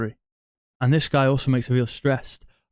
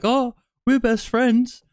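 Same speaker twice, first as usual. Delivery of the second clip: much higher, slow simpering mock voice; mocking nice guy; 11 semitones higher, slower than usual. Nice guy telling the girl they are best friends, high mock voice. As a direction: drag it out like a clingy best friend. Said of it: "You'll be like".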